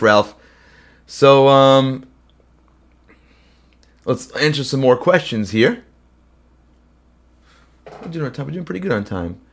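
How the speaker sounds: ordinary talk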